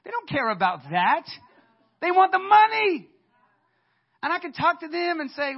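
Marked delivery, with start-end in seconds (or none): 2.0-3.1 high, slow shout of creditors' demand; loud emphatic demand. Retold or imitated. Preacher to congregation, shouting the lenders' demand. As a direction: belt it out as the creditors.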